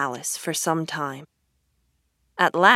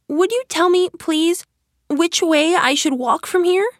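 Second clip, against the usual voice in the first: high, louder voice asking politely; polite Alice; 12 semitones higher, 5 dB louder than usual. Acted. Lost Alice asking the cat the way, high and polite. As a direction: ask sweetly in a high little voice.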